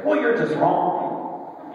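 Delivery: slow, high, scornful "Well, you're just wrong"; dismissive put-down. Retold or imitated. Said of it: "You don't have to just say".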